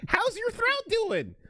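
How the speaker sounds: high silly clown voice. Retold or imitated. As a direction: squeal it out like a clown. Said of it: "it's not even the clown voice".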